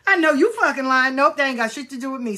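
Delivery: indignant rebuff. Retold or imitated. Acted rebuff of false reading.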